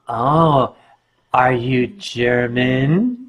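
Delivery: low, slow mother voice. Retold or imitated. Mother asks the costumed stranger, low slow question. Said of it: "She said".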